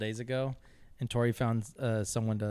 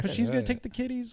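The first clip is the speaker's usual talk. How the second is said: put-on higher pleading girlfriend voice; higher apologetic mimic; imitating Tori; 12 semitones higher than usual. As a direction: lightly mimic her excuse. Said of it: "she's like".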